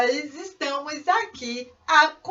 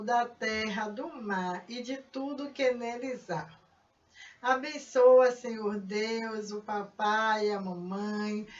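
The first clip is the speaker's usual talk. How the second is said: soft, lowered prayer voice; 4 semitones lower, 10 dB quieter than usual. Acted. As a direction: pray gently over the children. Leads a children's prayer.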